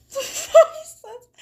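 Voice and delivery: high, loud laughter over words; dissolving into giggles; laughing. Real, not acted. Breaks into laughter.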